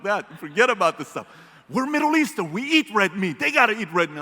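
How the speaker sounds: loud, high, worked-up telling; heated plea. Retold or imitated. Son arguing with dad about red meat, loud. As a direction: push back on dad with fire. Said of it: "I'm like".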